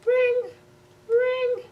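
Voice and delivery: high sing-song ring ring; doorbell ring. Acted. Reading aloud to kids, high chiming doorbell. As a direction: chime like a doorbell.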